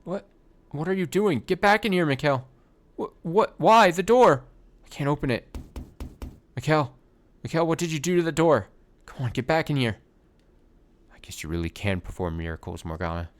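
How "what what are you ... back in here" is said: high frantic cries through the door; panicked shouting. Acted. Locked in, calling Mikhail back in terror. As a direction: pound on the door and yell for him in panic.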